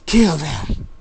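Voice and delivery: loud, high savage order; villainous shout. A narrator's reading. Laughing attacker ordering the slaughter, savage shout. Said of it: "The guy laughs and says".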